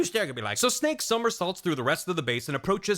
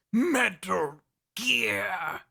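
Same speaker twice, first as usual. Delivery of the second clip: very drawn-out, raised delivery; hammed-up, slow final transmission; dramatic last words; 5 semitones higher, much slower than usual. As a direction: stretch out each word like a dying message. Dramatic final message quoted.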